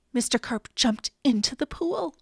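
usual speaking voice

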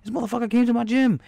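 put-on high, loud old trainer rant; Mickey impression. Trainer Mickey warning Rocky about the challenger, high loud voice. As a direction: rasp it up high like an old coach. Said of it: "Mick's like".

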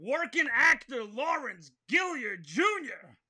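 slow, called-out name; announcer shout. Acted. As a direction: call the name out to the crowd. Host announces guest's name.

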